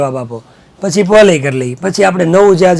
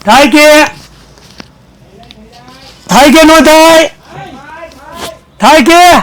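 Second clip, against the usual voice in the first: much higher and louder, calling the class to answer; 8 semitones higher, 4 dB louder, slower than usual. Retold or imitated. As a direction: shout the question to the room. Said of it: "જોરથી બોલ".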